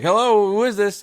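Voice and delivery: high, loud voice of the person answering; answering the buzzer. Retold or imitated. Neighbor answering the buzzer, high and loud. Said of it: "they're like".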